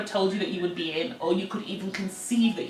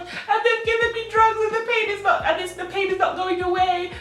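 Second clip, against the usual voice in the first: higher wailing plea, half-sobbed; imitating crying; 10 semitones higher than usual. Daughter wailing to her mum in labour, mock sobbing. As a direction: whine it like a helpless little girl. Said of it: "I start crying".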